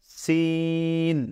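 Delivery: "সিন" with a long, level "ee"; model pronunciation. Shown as a demonstration. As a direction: stretch the vowel of "সিন" slowly and evenly. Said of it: "আমরা উচ্চারণ করবো এইভাবে".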